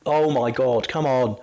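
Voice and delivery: ordinary talk, nothing marked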